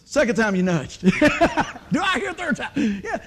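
laughing loud tease of the crowd. Real, not acted. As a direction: tease them through a laugh. Teasing congregation, laughing.

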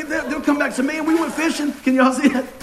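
ordinary talk, nothing marked

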